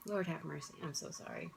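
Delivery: weeping, barely getting the words out; crying apology. Real, not acted. To her viewers, apologizing while crying over her pets. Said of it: "when I cry".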